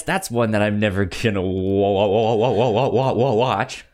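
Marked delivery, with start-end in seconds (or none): none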